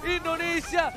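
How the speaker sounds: rapid hype play-by-play; fighting game announcer. Real, not acted. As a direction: call the fight fast and loud. Live play-by-play of teamfight.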